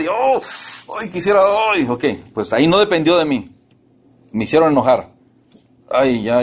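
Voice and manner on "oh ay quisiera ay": enojo imitado. Retold or imitated. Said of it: "nos van a hacer enojar".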